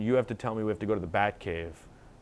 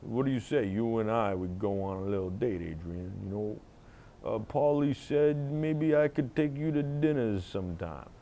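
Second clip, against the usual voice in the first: put-on Stallone Rocky accent. Rocky in a Philly accent asks Adrian on a date. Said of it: "crossed Dirty Harry and Stallone".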